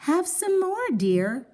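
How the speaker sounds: high doting wife; motherly coaxing. Acted. Wife voiced offering toast.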